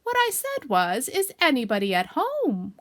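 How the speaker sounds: loud, slow, emphatic repeat; slow, insistent calling out; calling very loudly. Acted. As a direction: spell it out loudly for someone not answering. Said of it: "called Pooh out very loudly".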